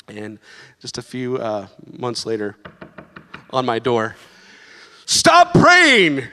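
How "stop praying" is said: high, loud mock shout of joy; joyful shout. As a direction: yell it from the doorstep in delight. Neighbor shouting at the door.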